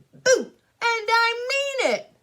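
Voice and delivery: loud, high, drawn-out threat; shouted. Acted. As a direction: shout it slowly like you mean it. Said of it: "I shouted".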